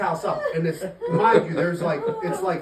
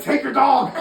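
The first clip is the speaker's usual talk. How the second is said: higher, louder, fed-up yell; re-enacted exasperated shout; 6 semitones higher, 6 dB louder than usual. Soaked rescuer pushing dog up to owner, re-enacted fed-up shout.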